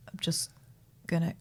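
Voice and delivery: hesitant, near-whispered mock excuse; halting hushed impression of someone caught out; imitating a sheepish intruder.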